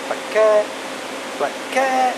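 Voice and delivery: high sing-song call; calling the cat, high and lilting. Real, not acted.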